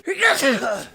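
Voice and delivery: high squeaky sneeze coming; words break into a sneeze; sneezing. Real, not acted. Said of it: "It came".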